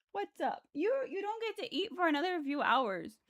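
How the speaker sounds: high, soft voice for a cat; pet talk. Real, not acted. Streamer telling the meowing cat to wait, high pet talk.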